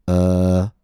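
"Uh" met een ontspannen neutrale tong; "Uh" kort, tong in het midden; uh vowel. Shown as a demonstration. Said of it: "It sounds like this".

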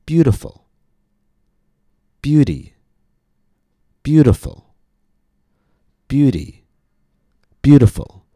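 "Beauty" and "Beautiful" with the first syllable high, then falling; stress on first syllable. Shown as a demonstration. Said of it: "the stress stays in the same place".

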